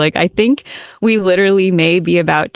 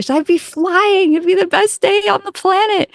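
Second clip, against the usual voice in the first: elated client voice; 13 semitones higher than usual. Retold or imitated. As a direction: float up as if already flying. Quoting the client's joy.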